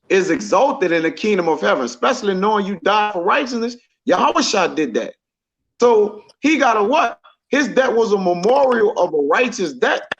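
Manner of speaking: usual speaking voice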